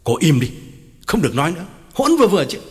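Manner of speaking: stern order rising in pitch; boss's commanding voice. Acted. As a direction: put an insolent employee in their place. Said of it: "ra lệnh bằng giọng chủ nhân". (ra lệnh bằng giọng chủ nhân)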